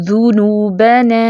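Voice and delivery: ذنوبنا slowly, lips rounded on the ذ. Shown as a demonstration. Teacher models the rounded ذ vowel slowly. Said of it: "پیش کے لیے ہونٹ گول کریں".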